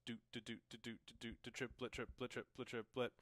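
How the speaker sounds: soft, even "do, do" running into "triplet, triplet"; vocalized drum rhythm. Shown as a demonstration. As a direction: tap out the rhythm with the voice, evenly. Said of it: "you're going to".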